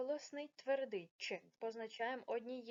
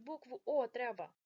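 ordinary delivery

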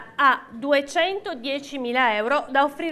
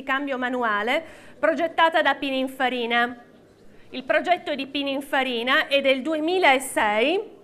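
ordinary talk

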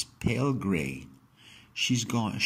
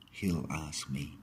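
plain reading voice

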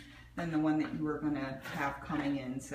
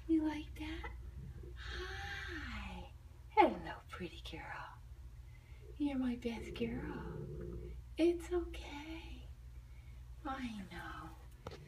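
high, sing-song coo to a shy cat; gentle high pet voice; 8 semitones higher, 5 dB quieter, much slower than usual. Real, not acted. Soothing the cat.

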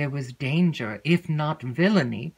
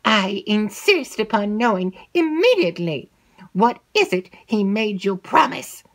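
firm, louder insistence; insistent; 6 semitones higher, 5 dB louder than usual. Acted. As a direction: demand it, no nonsense. Mistress insists.

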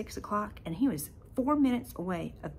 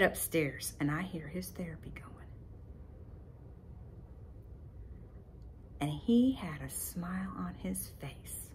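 whisper; 2 semitones lower, 8 dB quieter than usual. Real, not acted. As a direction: keep it secret, barely voiced. Sneaking up on son's therapy.

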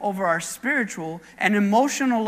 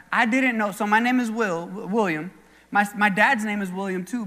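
normal talking voice

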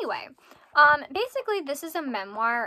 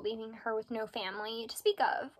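ordinary talk, nothing marked

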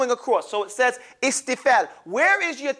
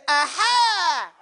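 shouted aha; 7 semitones higher than usual. Shown as a demonstration. Teacher leads the class in a shouted aha. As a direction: call the aha out big. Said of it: "take your hand, say".

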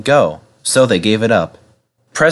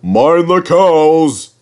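slow, high mocking call; whistled warning; 6 semitones higher, 4 dB louder, much slower than usual. A narrator's reading. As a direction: call it out long like a train whistle. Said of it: "he whistled".

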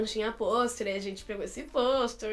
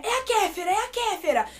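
high-pitched eager mock cry; excited sighting, re-enacted; 8 semitones higher than usual. Siblings spotting a passing woman, excited re-enacted sighting. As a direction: point her out in a high, excited voice.

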